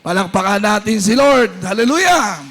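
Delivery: loud, ringing sermon climax; fiery preacher rallying the church; full-force preaching. Acted. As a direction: preach it out to the whole hall.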